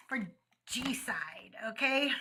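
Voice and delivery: slow hushed whisper. Pretend. Streamer marking the tab game for viewers, whispered. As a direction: lean in and whisper it.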